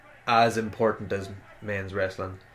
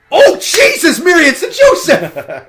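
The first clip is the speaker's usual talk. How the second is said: high, loud astonished cry; excited shout; 16 dB louder than usual. Real, not acted. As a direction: yell in genuine disbelief. Reacting live to the moonsault.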